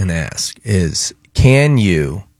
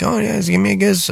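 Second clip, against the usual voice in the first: put-on high toothless old grandpa voice; grandpa asking for a kiss; 11 semitones higher than usual. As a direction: pitch way up as the old man. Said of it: "So he would be like".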